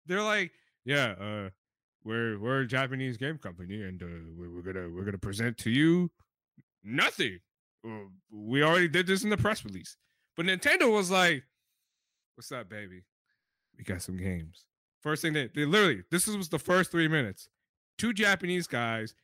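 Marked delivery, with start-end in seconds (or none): 0.8-10.0 deep, flat spokesman voice put on for a joke; mock company spokesman. Podcaster mocking a game company's presentation, deep put-on voice. 12.3-14.7 low, soft swagger; mock cool Nintendo. Podcaster voicing Nintendo bragging about its games, low and smooth.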